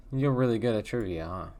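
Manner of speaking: ordinary talk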